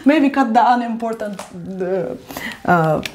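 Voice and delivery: ordinary delivery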